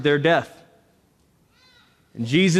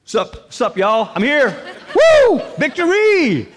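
high, loud, laughing showman; whooping, laughing Super Bowl star; mock party Jesus; 9 semitones higher, 5 dB louder than usual. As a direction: ham up a celebrity working the crowd. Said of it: "Jesus is, like, blowing kisses".

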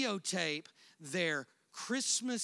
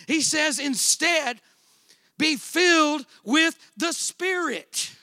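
preaching; 6 semitones higher, 9 dB louder than usual. Acted. Preaching at full force.

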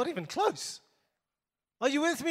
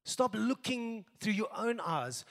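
ordinary delivery

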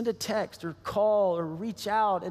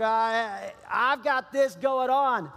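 dismissive brush-off; 5 semitones higher, much slower than usual. Pretend. Busy believer brushing off needs.

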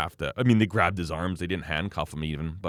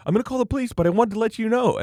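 imitated caller, pitched way up; downstairs lady; 14 semitones higher, 4 dB louder, faster than usual. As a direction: put on a high neighbour-lady voice. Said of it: "so she goes".

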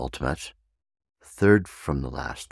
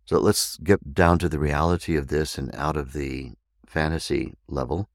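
usual speaking voice

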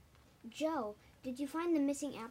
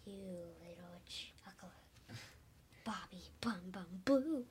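whispering; 3 semitones lower, 4 dB quieter than usual. Real, not acted. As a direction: whisper a taunt. Kid teasing dad in whisper.

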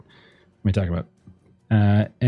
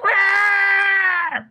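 high, sharp goblin yell of triumph; a goblin's triumphant yell; goblin shout. A narrator's reading. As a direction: bark out a short victory cry. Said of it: "the goblin shout".

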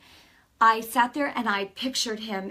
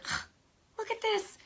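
higher, softer, puzzled aside; a hushed surprised nudge; surprised huh; 13 semitones higher, 6 dB quieter than usual. Retold or imitated. Nudging her husband in church, a higher hushed surprise.

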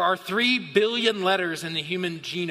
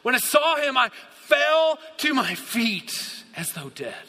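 dramatic scripture reading; 6 semitones higher than usual. Acted. Preacher reading John's collapse before Christ, dramatic and falling. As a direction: build on FELL, then let it drop away.

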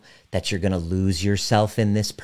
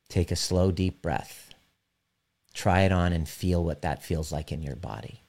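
soft and lower, like leading a meditation; guided meditation; 3 semitones lower, 4 dB quieter than usual. Acted. Leads a guided visualization.

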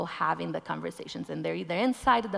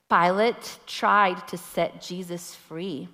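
usual speaking voice

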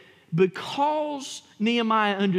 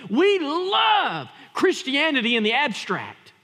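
louder, higher and slower; emphatic repeat; 7 semitones higher, 4 dB louder, slower than usual. Shown as a demonstration. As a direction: hammer the point home. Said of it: "repeated line, second punched".

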